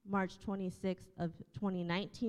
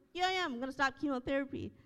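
higher-pitched, quick mock comeback; retold insistence; 7 semitones higher, faster than usual. Patient retelling how she contradicted her doctor, insistent. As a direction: lightly act out digging your heels in.

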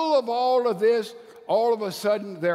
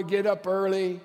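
usual speaking voice

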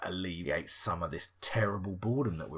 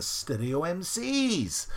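put-on high, drawn-out Welsh accent; 8 semitones higher, much slower than usual. Quiz host giving an answer, in a Welsh accent. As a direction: put on a Welsh accent with a long rising-falling ending. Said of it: "I do that in a Welsh accent".

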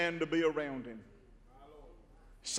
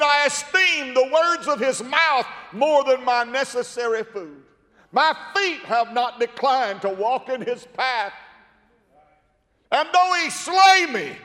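loud, high shouted preaching; preaching at full force; 8 semitones higher, 7 dB louder than usual. Real, not acted. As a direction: lift the congregation with a shout.

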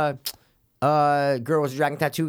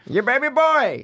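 movie quote; 8 semitones higher, slower than usual. Retold or imitated. Repeating the movie line.

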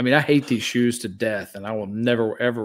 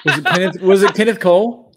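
eager; 6 semitones higher, 9 dB louder than usual. Real, not acted. Eager guess about the brand.

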